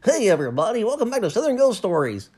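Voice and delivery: high, loud, bright announcer voice; peppy morning weatherman read. Shown as a demonstration. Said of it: "A M weatherman reporter voice".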